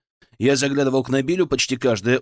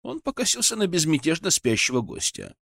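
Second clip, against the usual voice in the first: ordinary delivery, nothing marked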